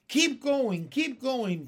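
high, loud repeated cry; excited chanting shout; urging cheer. A narrator's reading. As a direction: cheer them on insistently. Crowd cheering James on.

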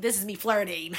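tickled mock boast ending in a laugh; amused, breaking into laughter.

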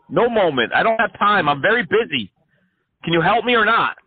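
high, excited ranting crank; manic caller. Acted. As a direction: rant like an unhinged crank caller. Crank caller rants at scammer.

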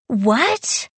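high, startled question; taken aback. A narrator's reading. Girl hearing her cousin's secret, taken aback. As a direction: exclaim it with a rising, startled lift. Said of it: "I exclaimed, taken aback".

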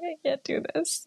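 tearful. Retold or imitated. Crying at home, retold.

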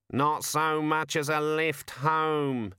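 a sour raven grumbling; cartoon raven muttering its complaint. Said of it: "it muttered".

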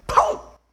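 sudden loud "pow"; imitated slap. Preacher re-enacting his pastor's slap, explosive "pow". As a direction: punch out the slap sound.

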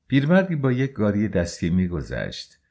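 ordinary delivery

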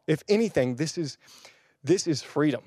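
ordinary delivery

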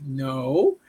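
low, unimpressed answer; deadpan denial. Retold or imitated. Cashier answering a customer's wrong guess, deadpan retold denial. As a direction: drop it low, mock-patient.

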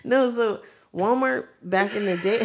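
laughing through the words; giggling while talking. Real, not acted. Laughing while starting story.

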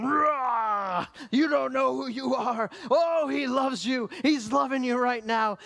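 loud, high, re-enacted roar over someone; roaring proclamation. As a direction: roar out love with full force. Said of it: "couldn't take the roaring".

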